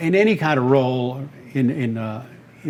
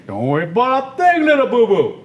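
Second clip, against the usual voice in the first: higher, confident cartoon bear reassuring his friend; Yogi Bear; 8 semitones higher, 4 dB louder than usual. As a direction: soothe a nervous pal with swagger. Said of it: "Yogi Bear".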